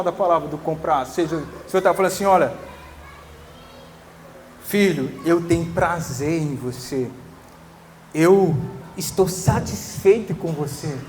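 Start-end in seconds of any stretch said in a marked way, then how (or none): 2.2-11.0 soft, lower, gentle assurance; quiet loving reassurance; tender father voice. Retold or imitated. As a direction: speak it like a father comforting his child. God speaking lovingly to son.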